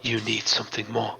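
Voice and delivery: a grave priestess asking about his faith; the mystic Meningian testing the hero, hushed and grave; solemn mystic. Acted.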